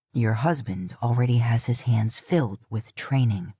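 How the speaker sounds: put-on low, grumbling old father; gruff father.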